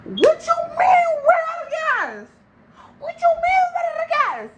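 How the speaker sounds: very high, loud, slow incredulous shriek; outraged squeal. Retold or imitated. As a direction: shriek it in mock outrage at him. Mock outraged squeal at driver.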